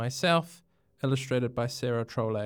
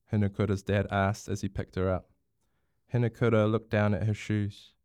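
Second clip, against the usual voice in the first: ordinary delivery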